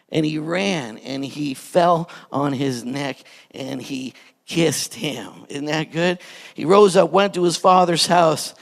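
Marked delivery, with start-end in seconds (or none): 0.1-5.5 tender. Acted. Preacher retells tender reunion.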